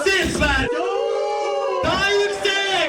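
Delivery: high, loud hype shout; excited hype. Acted. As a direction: pump up the crowd. Hyping the lot. (heyecanlı bağırış)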